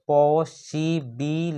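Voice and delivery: Possibility slowly, पॉ - सि - बि - लि - टी; five separate beats on possibility; split into syllables. Shown as a demonstration. Teacher counting syllables for students, possibility split into five. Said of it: "यहाँ पे five syllable है".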